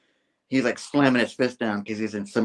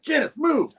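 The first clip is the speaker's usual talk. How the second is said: urgent shout. Acted. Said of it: "It's like".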